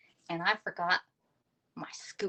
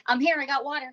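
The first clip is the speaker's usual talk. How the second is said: higher, louder mock reply as Mary; voicing Mary; 7 semitones higher, 5 dB louder than usual. Host voices a moderator answering from chat, higher and louder. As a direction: pipe up brightly like the friend with her water. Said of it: "said Mary".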